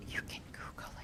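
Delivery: whispered aside; a breathy whispered aside. Real, not acted. Aside to listeners.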